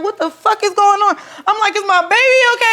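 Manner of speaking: panicked questions. Retold or imitated. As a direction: demand answers in a rising panic. Scared mother asking staff.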